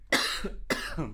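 sudden harsh coughing; a few hard, rough coughs; coughing fit. Real, not acted. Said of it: "aż mnie kaszel złapał".

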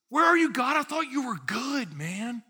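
high-pitched mock whine, starting loud; indignant complaint. Entitled believer challenging God when circumstances change, indignant. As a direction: call out accusingly, then ease off in volume.

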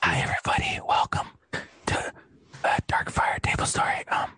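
hushed, breathy-close whisper into the mic; a parody ASMR whisper, fully unvoiced; mock ASMR whisper. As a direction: whisper it like a joke ASMR video. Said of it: "can I do some A S M R real quick".

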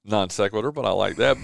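ordinary talk

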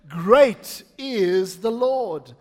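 loud, slow declaration with GREAT punched. Shown as a demonstration. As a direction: proclaim it with passion, stretching each word. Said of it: "With a bit more passion".

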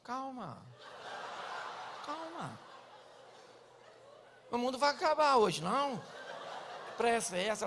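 mock unhurried calming; slow laid-back husband. Husband calming his hurried wife, mock-lazy. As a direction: soothe her lazily, no rush. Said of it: "E o marido fala assim".

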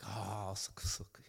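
soft breathy mock delight; whispered satisfaction. Preacher voicing himself posting the relationship. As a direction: sigh it out, content and hushed. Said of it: "it's like".

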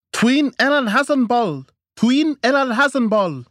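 repeated high, loud yell of rage; enraged yelling. A narrator's reading. Wife at her husband, yelling in rage. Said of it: "Trina yelled repeatedly on an exponential upward curve of rage".

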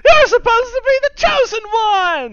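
very high, strained mock-tragic yelling; hammed-up anguished shout. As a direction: wail it like a betrayed movie hero. Acting out Star Wars betrayal scene.

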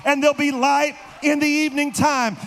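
usual speaking voice

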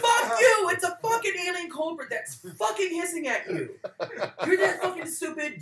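high, loud comic outburst; hammed-up furious shouting; outraged yelling. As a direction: explode at the idiot in disbelief. Yells back at the foolish character.

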